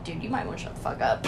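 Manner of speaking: retold hushed warning. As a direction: warn him under your breath.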